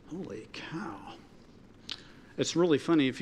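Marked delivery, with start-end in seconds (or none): 0.1-1.2 awed whisper. Real, not acted. Awed aside after prophecy.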